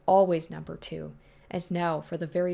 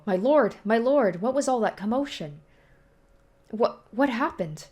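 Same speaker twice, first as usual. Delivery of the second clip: higher, urgent servant voice; alarmed servant; 5 semitones higher, 4 dB louder than usual. Acted. Servant bursting in on his lord, higher and alarmed. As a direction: rush in fearing for your master.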